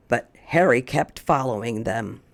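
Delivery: plain reading voice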